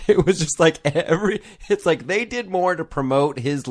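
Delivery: high, loud disbelief; incredulous. Real, not acted. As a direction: burst out in disbelief. Incredulous outburst.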